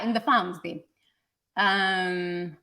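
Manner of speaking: ordinary delivery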